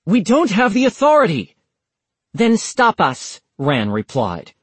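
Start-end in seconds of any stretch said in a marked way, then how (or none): 0.0-1.6 loud, high insistent yell. A narrator's reading. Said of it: "Walker yelled". 2.3-3.4 cool defiance. A narrator's reading. Ran defiant reply.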